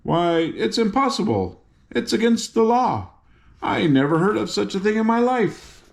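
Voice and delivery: high, disbelieving guardian; astounded. Acted.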